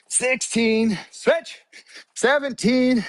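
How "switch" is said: high, punchy coach cue; called-out cadence. Real, not acted. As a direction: call each switch to keep the class on beat. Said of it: "When I say switch, you switch".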